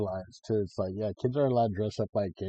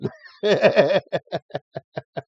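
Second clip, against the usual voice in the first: loud, high peal of laughter; hearty laugh; 8 semitones higher, 10 dB louder than usual. Real, not acted. Amused by an old shirt slogan, loud laugh.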